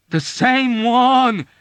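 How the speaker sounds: high loud slow quivering echo; ominous excitement. A narrator's reading. Yossarian echoing Dunbar with ominous excitement. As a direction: echo it, shaking with dread. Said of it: "quivering with a deep and ominous excitement".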